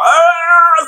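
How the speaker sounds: high, loud mock scream; exasperated scream. Parent overwhelmed by children arriving, acted exasperated scream. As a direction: let out a theatrical cry.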